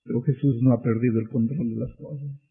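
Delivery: hushed preacher's reassurance; quiet. Acted. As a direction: say it softly, almost to yourself. Same line repeated, quiet then loud.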